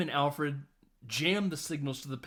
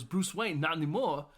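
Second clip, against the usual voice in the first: Christopher Walken; 3 semitones higher than usual. Retold or imitated. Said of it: "Christopher Walken pulls his gun out and goes".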